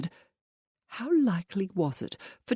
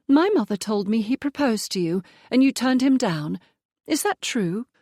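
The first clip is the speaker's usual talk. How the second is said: put-on higher, louder nosy young lady; prying Penelope; 6 semitones higher, 4 dB louder than usual. A narrator's reading. Penelope prying into the proposal, higher and eager. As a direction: push for gossip, higher and eager.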